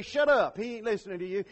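ordinary talk

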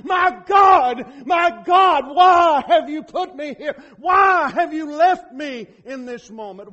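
loud, high shouted plea; anguished cry. Retold or imitated. Preacher voicing Jesus crying to God on the cross, loud anguished shout. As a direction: cry it out like a forsaken son. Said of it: "that's why he cried".

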